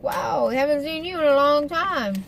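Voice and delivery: lightly hammed-up coworker surprise; excited coworkers. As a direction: imitate coworkers gushing at a returning friend. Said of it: "They're like".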